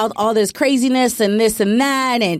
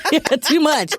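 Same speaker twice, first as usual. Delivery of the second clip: laughing; 6 semitones higher, slower than usual. Real, not acted.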